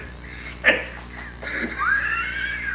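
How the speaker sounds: very high, breathless howl of laughter; helpless high laugh; shrieking laugh. Real, not acted. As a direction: crack up at the memory. Laughing at his wild pitch.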